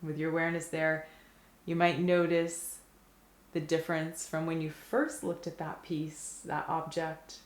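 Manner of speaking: guided meditation. Acted. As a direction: guide their attention gently, leaving silence after each phrase. Leads mindful looking at food.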